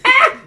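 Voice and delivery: short, high, loud scream; sudden shrieked "Ah!"; mock scream. Host reenacting the mic arm snapping off, mock scream. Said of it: "me screaming".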